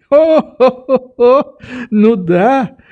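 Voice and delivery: high, deliberate "ho-ho-ho" stage laugh; chuckling old doctor. A narrator's reading. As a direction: chortle as the old man, then agree. Old doctor laughs along with Nelly.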